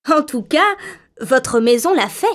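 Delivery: ordinary delivery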